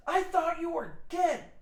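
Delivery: high, loud mock-indignant husband; shocked outburst. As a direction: blurt it out as the shaken husband. Startled father reacts to the prank.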